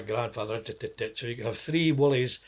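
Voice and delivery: ordinary talk, nothing marked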